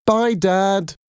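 high, called-out goodbye across the flat; children shouting goodbye. Acted. Children call goodbye to their dad as they leave, shouting. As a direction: call it back over your shoulder. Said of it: "they shouted as they left the flat".

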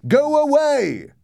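drawn-out, desperate high yell; shout. A narrator's reading. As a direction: shout it long and loud in terror. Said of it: "I shouted".

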